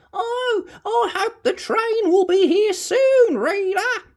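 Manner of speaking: put-on very high quavering old lady voice; high shaky granny; granny Madge. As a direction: play a wobbly old granny.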